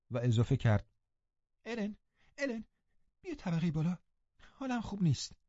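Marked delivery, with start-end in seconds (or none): 1.7-5.4 put-on high, soft, anxious girl's voice; a frightened girl calling her nurse; lightly voiced upset girl; distressed young girl. Catherine pleads with Ellen.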